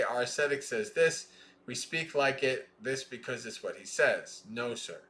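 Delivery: measured, deliberate scripture reading; sutta recitation. Acted. Teacher reading the sutta to students, measured recitation. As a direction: recite each line of the chain steadily.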